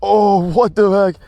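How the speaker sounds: loud, high startled yell; spooked. Real, not acted. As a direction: cry out from a real fright, easing slightly. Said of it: "That spooked me so bad".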